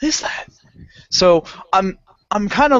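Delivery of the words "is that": high, breathless frightened whisper; scared whisper. Retold or imitated. Hiding in the pool as the light nears, scared whisper. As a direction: hiss it out, spooked.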